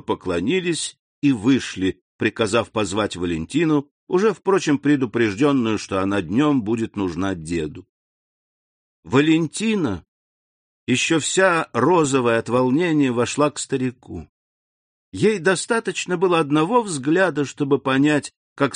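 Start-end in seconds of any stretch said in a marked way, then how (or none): none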